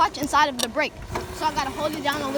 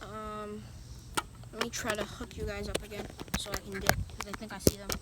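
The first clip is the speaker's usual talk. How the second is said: hushed; 5 semitones lower, 12 dB quieter than usual. Real, not acted. Hiding from chasers, hushed.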